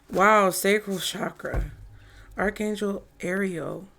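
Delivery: ordinary talk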